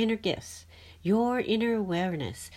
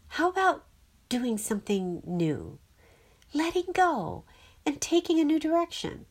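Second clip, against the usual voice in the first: coaxing Clacy; 8 semitones higher than usual. A narrator's reading. Clacy proposes change.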